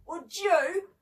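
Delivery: a touch of a gasp. A narrator's reading. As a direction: raise it as if catching your breath. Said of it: "gasped David".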